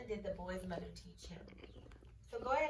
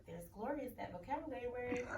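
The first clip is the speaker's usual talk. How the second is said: normal talking voice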